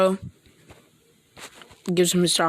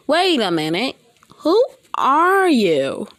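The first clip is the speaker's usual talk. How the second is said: meme impression; 7 semitones higher, 3 dB louder than usual. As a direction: stretch it out like the meme, pitched up. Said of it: "Like that little meme".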